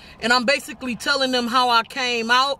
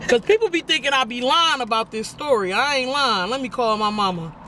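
usual speaking voice

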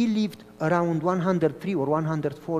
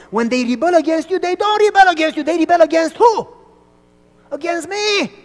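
high, loud, fast proclamation; God's voice; 9 semitones higher, 9 dB louder than usual. Retold or imitated. God telling Moses who they rebel against, loud high proclamation. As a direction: declare it with divine force.

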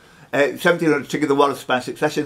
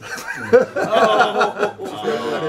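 a long amused burst of laughter; hearty laugh; 7 semitones higher than usual. Real, not acted. Host laughs at Woodstock joke.

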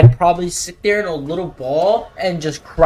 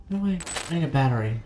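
ordinary talk, nothing marked

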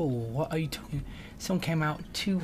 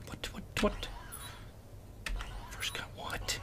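whispered; 5 semitones higher, 13 dB quieter, slower than usual. Real, not acted. Unvoiced confused aside.

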